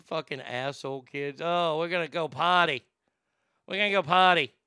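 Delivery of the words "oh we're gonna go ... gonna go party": playful mock Boston accent. Asshole kids heading out to party, mock Boston accent. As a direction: put on a Boston accent, dropping the R. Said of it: "working on my Boston accent".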